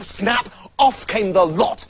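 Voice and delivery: plain reading voice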